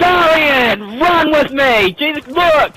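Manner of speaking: high, urgent call; exasperated yell. Real, not acted. Player begging Darian to follow, exasperated yell.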